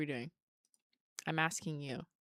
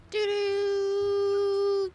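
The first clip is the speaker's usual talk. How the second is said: high, loud two-note fax beep; fax machine imitation; 14 semitones higher, 7 dB louder than usual. Shown as a demonstration. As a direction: chirp it out like an old fax machine. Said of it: "What does it sound like again?".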